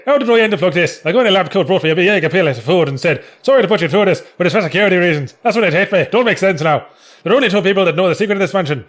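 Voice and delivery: hammed-up Irish, rattled through with bouncing pitch; comedy Irish accent. Reading a comic strip to his co-host, comedy Irish accent. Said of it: "comedy Irish accent".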